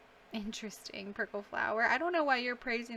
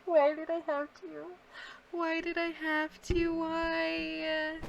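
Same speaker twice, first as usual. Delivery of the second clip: anguished; 7 semitones higher, slower than usual. Real, not acted. Anguish after a cat dies.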